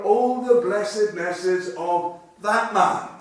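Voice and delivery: loud, slow proclamation; exclamation. Shown as a demonstration. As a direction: ring it out, stressing each word. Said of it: "it's an exclamation".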